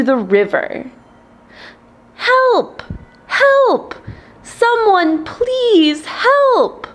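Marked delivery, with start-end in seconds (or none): 2.1-6.9 small high ant voice, crying out; desperate high-pitched call for help; screaming for help. A narrator's reading. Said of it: "screaming for help".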